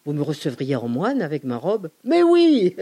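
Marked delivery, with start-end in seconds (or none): 2.0-2.8 much higher, louder burst of delight; eager yes. Retold or imitated. Welcoming the monk as a client, high excited cry. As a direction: shoot it up high with glee.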